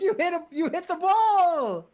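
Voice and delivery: high, slow mock disbelief; surprised. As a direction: squeal it in stunned surprise. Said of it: "He's like".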